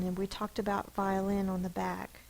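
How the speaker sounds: ordinary talk, nothing marked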